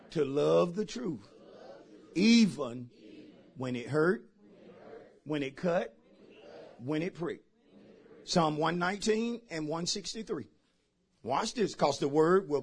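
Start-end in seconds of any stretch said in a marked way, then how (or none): none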